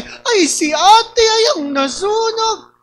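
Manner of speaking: high, very slow drawn-out Batangas mother; mother's voice. Retold or imitated. Imitating his mother exclaiming, drawn-out and high. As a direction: stretch it out like an alarmed mom. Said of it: "galit na galit na si nanay".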